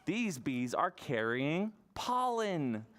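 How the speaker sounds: ordinary delivery, nothing marked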